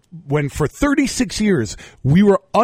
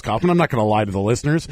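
ordinary talk

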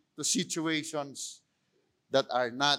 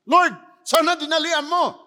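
complaining plea to God; 10 semitones higher, 9 dB louder than usual. Retold or imitated. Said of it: "A typical response that we have with God".

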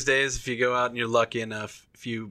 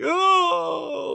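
long, high pained "oh"; mock pained groan. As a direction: wail as if hit below the belt. Acts out groaning in pain.

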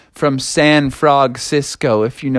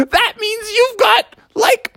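very high cackling teacher mocking; hyena laugh; slower than usual. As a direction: cackle like a hyena around the words. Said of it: "started laughing like a hyena".

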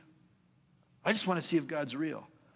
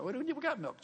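high, reluctant protest; whiny objection; 10 semitones higher, 4 dB quieter than usual. Pretend. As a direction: push back like a reluctant kid. Mock reluctant listener objecting.

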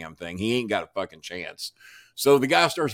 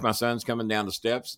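ordinary talk

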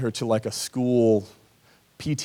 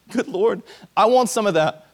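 high, eager, louder cry of wanting; eager exclamation; 8 semitones higher, 4 dB louder than usual. Retold or imitated. Parents hearing the gospel at church, high eager exclamation. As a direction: grab at it like a starving man.

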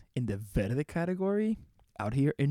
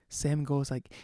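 ordinary delivery

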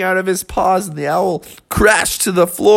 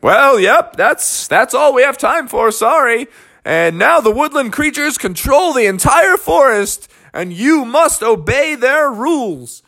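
high, hammed-up official's voice; officious referee; 7 semitones higher than usual. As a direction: proclaim it to both teams. Referee announces the result.

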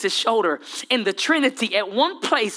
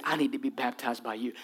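soft low plea; 9 semitones lower, 10 dB quieter than usual. Acted. John repeats his plea.

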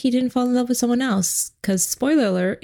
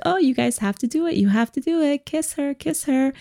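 excited, higher-pitched imitation of an eager family; mock excited; 6 semitones higher than usual. Whole family urging the couple to kiss, mock excited. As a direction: egg the couple on with put-on excitement. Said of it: "excitedly like".

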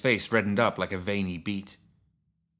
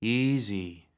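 soothing, drawn-out coo; gently calm a frightened horse. A narrator's reading. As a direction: coo it tenderly. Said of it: "she cooed".

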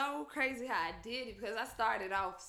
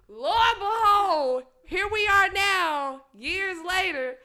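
loud, slow, drawn-out announcement; grand proclamation; 5 semitones higher, 9 dB louder, slower than usual. Acted. Storyteller revealing how it turned out years later, mock-grand proclamation. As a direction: proclaim it slowly, pitched up, for comic effect.

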